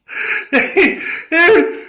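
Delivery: laughs high at the memory; a real, high laugh; high burst of laughter. Real, not acted. Laughing at the story.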